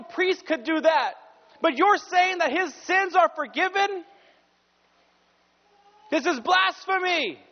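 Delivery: high-pitched scandalized objection; upset accusers. Acted. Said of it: "They were upset that he said".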